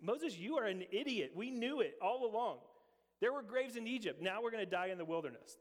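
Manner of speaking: a hammed-up grumbling accusation; grumbling complaint. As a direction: whine it at him like a fed-up crowd. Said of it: "They grumble against".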